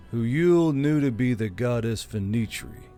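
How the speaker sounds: ordinary delivery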